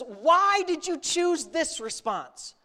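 high, slow challenge; bold rebuke. Acted. Jesus boldly challenging his disciples' response, emphatic. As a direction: press each word as a pointed question. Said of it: "I love how he says".

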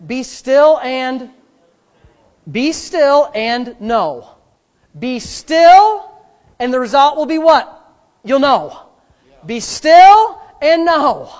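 loud, slow, drawn-out proclamation; emphatic preaching. Real, not acted. Preacher drilling a Psalm into the congregation, emphatic preaching. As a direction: hammer the verse home word by word.

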